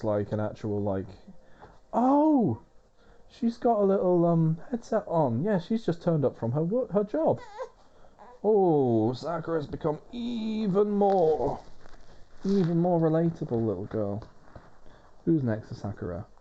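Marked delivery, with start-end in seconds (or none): 1.9-2.6 high, loud burst of delight; a sudden thrilled oh; surprised oh. Real, not acted. Sudden delight at character design. 3.3-7.4 excited. Real, not acted. Excited about a character's outfit. 8.4-14.2 gushing. Real, not acted. Gushing over a character.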